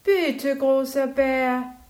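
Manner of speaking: high, loud, drawn-out plea; pleading. Acted. Little bear begs big bear, drawn-out plea. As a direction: beg him sweetly, stretching it out. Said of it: "bat der kleine Bär".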